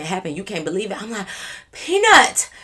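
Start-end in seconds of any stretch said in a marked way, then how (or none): 1.7-2.5 loud, high shocked shout of her name; shocked yell. Retold or imitated. Friend shocked the drunk girl splashed an officer, loud high yell.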